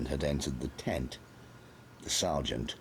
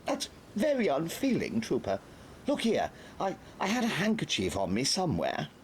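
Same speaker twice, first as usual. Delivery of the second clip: much higher, prim scolding officer; fussy Major; 12 semitones higher than usual. A narrator's reading. The Major scolds Trooper Gabatas, fussy. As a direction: tut at the trooper, then fuss.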